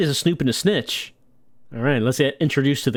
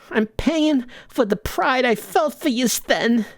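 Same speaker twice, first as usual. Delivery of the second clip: put-on much higher old mother voice; old Italian mama; 8 semitones higher than usual. Mama scolding her gangster son, high and tearful. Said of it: "we're talking to Mama".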